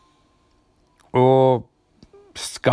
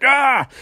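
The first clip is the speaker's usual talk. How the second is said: high, drawn-out comic "ah"; short high-pitched mock scream; mock cry of pain; 10 semitones higher, 3 dB louder than usual. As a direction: yelp as if falling, played for laughs. Acted cry as he falls.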